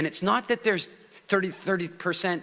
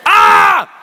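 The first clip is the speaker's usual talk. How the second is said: loud startled shout; 12 semitones higher, 14 dB louder than usual. Shown as a demonstration. Bursting out of the monotone.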